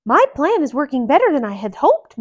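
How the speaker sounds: high, quick mouse voice; Mickey thinking. Acted. Mickey pleased with his plan, quick high voice. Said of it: "Mickey thought".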